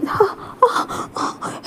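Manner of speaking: soft unvoiced sobbing yeses; crying. Wounded Wing crying in Wang Ji's arms, whispered sobs. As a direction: whimper through the tears. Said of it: "wing बस ऐसे रोने लगता है".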